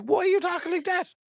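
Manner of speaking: very high, mock-indignant squawk; acted indignant reply. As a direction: protest it high in disbelief. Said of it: "You'd be like".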